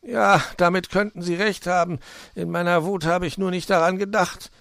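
high, loud, plaintive fox; whining. A narrator's reading. Fox admitting Puh is right, whining plaintively. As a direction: moan your admission, pitched well up. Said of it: "jammerte der Fuchs".

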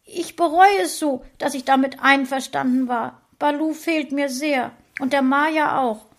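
wife sobs over the lost dog; sobbing. A narrator's reading. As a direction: cry out her regret. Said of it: "weinte Frauchen richtig und schluchzte".

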